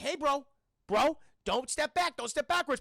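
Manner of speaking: acted alarm, punching each plea; urgent warning. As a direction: call out to halt someone at a ledge. Retold warning to man by window.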